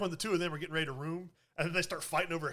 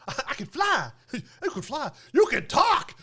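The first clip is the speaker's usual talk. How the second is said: high, excited Donkey, much louder on the last line; a giddy talking donkey, getting louder; 9 semitones higher, 7 dB louder than usual. Retold or imitated. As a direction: squeal it out like the cartoon donkey. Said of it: "And he's like".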